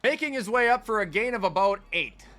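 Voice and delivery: excited sports commentator calling the game; hyped game-day announcer; play-by-play. Acted. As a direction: call the action like a live broadcaster. Live play-by-play of his game.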